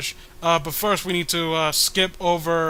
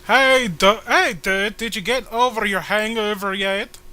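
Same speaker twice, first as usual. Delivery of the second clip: loud cheery coworker voice; 4 semitones higher, 6 dB louder than usual. Acted. Voicing comic side characters.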